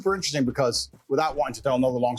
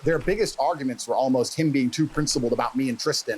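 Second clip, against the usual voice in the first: normal talking voice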